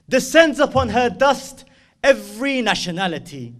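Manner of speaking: loud, high declaimed verse; full-force spoken poetry, drawn-out words; impassioned poem recital. Acted. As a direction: proclaim each line like a rallying cry.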